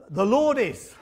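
put-on halting start-stop recital like a frightened little boy on stage; hammed-up stage fright, words stuck on the Lord; nervous halting recitation. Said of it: "nerves got the better of him".

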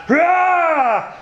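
high, drawn-out roar; lion's roar. Acted. Prideful lion roars at a monkey, roaring. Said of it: "he roars out".

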